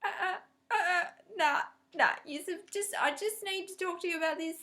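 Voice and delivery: high, loud laughing no; mother laughing off the son's request; laughing refusal. Retold or imitated. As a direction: laugh while shutting it down. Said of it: "And I'm going".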